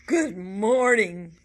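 slow, loud, grumpy greeting; teacher greets the class grumpily. A narrator's reading. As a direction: drag it out like a cranky teacher. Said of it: "in an unusually grumpy voice".

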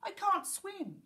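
high-pitched panic, acted without extra volume; screamed plea. A narrator's reading. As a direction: wail it high like a drowning pirate. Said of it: "he screamed".

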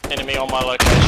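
loud urgent callout; shouted callout. Real, not acted. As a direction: shout it to the squad. Urgent callout under fire.

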